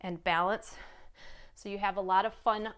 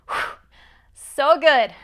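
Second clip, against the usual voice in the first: ordinary delivery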